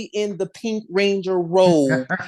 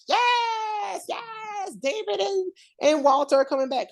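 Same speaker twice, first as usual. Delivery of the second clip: mock fan excitement; 13 semitones higher, 4 dB quieter than usual. Fans cheering David and Walter's return, mock excitement. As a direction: squeal it like an overjoyed fan. Retelling fans' reaction to the news.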